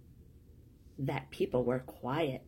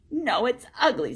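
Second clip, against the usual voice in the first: upset protest; 7 semitones higher, 7 dB louder than usual. Acted. Boy rejects the man's comfort.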